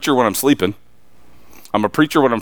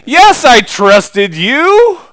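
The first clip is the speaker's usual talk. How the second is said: slow, drawn-out, louder plea; believer's plea; 11 semitones higher, 8 dB louder, much slower than usual. Retold or imitated. Preacher voicing a believer before God, slow and loud. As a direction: stretch it out like pleading your case. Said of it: "to say".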